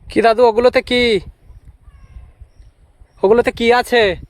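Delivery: to someone hard of hearing. Real, not acted. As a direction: speak up so he can hear. Calling to hard-of-hearing old man.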